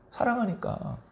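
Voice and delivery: 사랑하니까 quietly and falling; soft echo. Shown as a demonstration. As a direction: let 사랑하니까 fall away gently. Soft echo of key word.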